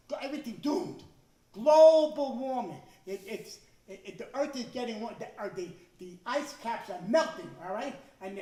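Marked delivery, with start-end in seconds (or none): 1.5-2.8 dramatic announcer. Retold or imitated. Dramatic TV title.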